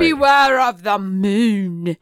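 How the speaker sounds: slow, loud mock English warning; hammed-up British accent, slow and loud; British accent attempt. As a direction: boom it out like an old English villager. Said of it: "Did I sound like I was English?".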